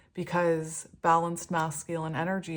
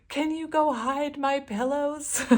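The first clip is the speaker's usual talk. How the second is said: mock pleading request; 8 semitones higher, 4 dB louder than usual. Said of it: "I'll be like".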